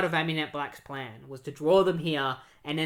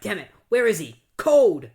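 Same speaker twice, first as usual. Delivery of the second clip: louder, rising angry call ending in a yell; frustrated shout; 5 semitones higher, 10 dB louder than usual. Retold or imitated. Wally calling after Captain Cold, frustrated loud shout. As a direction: yell the name after the escaping villain. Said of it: "Wally's just like".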